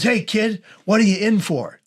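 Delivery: lightly voiced cheerful friar; jovial. Friar breezily greets a new psych ward patient, jovial. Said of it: "Like, all jovial".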